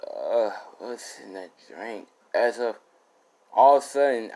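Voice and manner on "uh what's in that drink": slow, low woozy mumble; acted drugged daze, softer and slower; drugged wooziness. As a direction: drag it out as if the drink is kicking in. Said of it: "he's feeling it".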